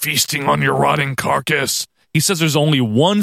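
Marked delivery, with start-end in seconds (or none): 0.0-1.9 Undertaker impression. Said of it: "Undertaker cuts some weird-ass promo".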